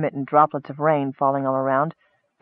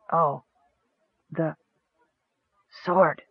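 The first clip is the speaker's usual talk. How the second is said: soft and halting; weak whisper; 6 dB quieter, slower than usual. A narrator's reading. Dying goblin asking for the sword, weak whisper. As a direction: push the words out with no strength. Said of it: "whispered the goblin".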